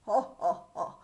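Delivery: loud ha ha ha; hearty king laugh. Acted.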